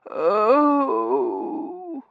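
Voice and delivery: long, high, loud swooning moan; theatrical moan on coming round; high-pitched drawn-out "oh". A narrator's reading. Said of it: "Missus Mearing moaned".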